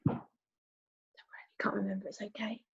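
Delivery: unvoiced gentle whisper; soft reassuring whisper. Real, not acted. Reassuring quiet aside.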